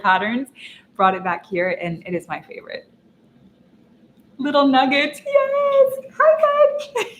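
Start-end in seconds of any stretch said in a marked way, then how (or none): 4.4-7.1 very high, sing-song cooing. Real, not acted. Greeting her puppy Gus on camera, high cooing baby talk. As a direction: squeal sweetly at the puppy.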